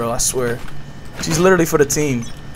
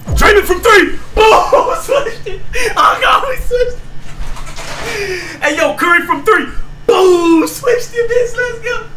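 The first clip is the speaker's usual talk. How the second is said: hyped sports-call shout; much slower than usual. Pretend. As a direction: scream it like a game-winning basket call. Mock sports call of a great shot.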